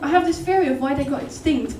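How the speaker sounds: usual speaking voice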